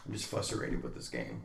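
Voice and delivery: low, soft mock pout; imitated sulky child. Dad quoting his upset little daughter, quiet sulk. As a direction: mumble it like a sulking toddler.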